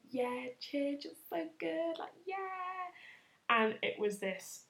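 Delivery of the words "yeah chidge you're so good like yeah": imitated friend cheering her on, climbing higher; gushing friend. Friend approving her message to the guy, mock gushing. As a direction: softly squeal encouragement as a hyped-up friend.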